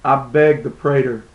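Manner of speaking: normal talking voice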